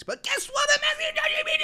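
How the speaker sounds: high defiant shout. Real, not acted. Streamer defying his girlfriend's objections, shouting. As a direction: yell it at the haters. Defiant about asking ChatGPT.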